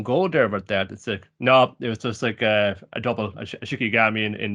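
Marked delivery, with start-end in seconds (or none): none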